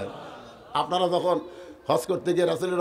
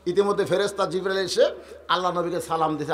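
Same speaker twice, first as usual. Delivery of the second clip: ordinary talk, nothing marked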